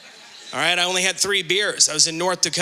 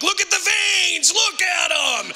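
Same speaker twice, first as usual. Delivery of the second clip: proud shout; 11 semitones higher, slower than usual. Acted. Showing off to wife, acted.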